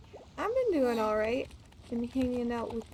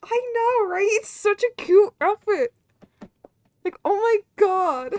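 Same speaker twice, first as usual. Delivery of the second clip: higher, louder squealing delight; excited squeal; 7 semitones higher, 10 dB louder than usual. Real, not acted. Streamer squealing to chat about a cute outfit.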